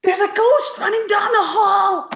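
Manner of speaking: high, loud, frantic with fright; panicked. Acted. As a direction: blurt it out in terror. Irma panicked about ghost.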